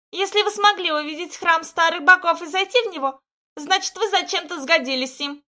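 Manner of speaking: put-on high, loud cawing old woman; croaking crone. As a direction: caw it out like a crow. Guardian pronounces.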